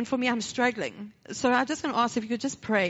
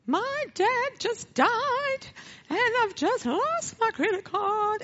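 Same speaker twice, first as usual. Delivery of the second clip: high, slow crying voice; sobbing wail; 12 semitones higher, slower than usual. Real, not acted. Breaking down to the toll lady, sobbing. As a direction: wail it out through sobs, high and stretched.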